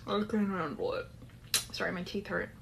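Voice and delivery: plain reading voice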